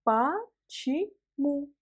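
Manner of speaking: "Почему" very slowly as pa-chi-mu, a pause between syllables; syllable-by-syllable pronunciation. Shown as a demonstration. Teacher has learner repeat along, "Почему" slowly syllable by syllable. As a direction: break "Почему" into three drawn-out syllables, first O said as A. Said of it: "Il faut prononcer le premier O comme A".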